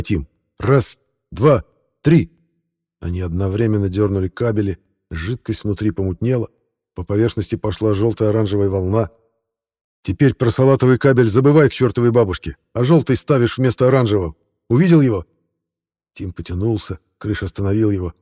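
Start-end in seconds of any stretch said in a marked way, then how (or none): 0.6-2.3 loud count. Acted. Counting off a joint pull. 10.0-15.3 urgent instructions. Acted. Character giving urgent instructions.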